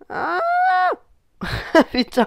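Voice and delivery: high startled yelp then laugh; sudden cry. Real, not acted. Startled cry at the game, then laughs. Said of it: "Je crie, mais comme un con".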